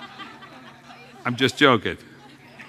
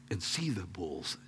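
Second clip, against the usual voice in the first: whisper; 6 semitones lower, 12 dB quieter than usual. Retold or imitated. Whispered aside in quoted invitation.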